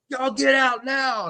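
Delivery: high, slow, drawn-out yell; angry ordering out. Retold or imitated. Sister throwing everyone out, angry.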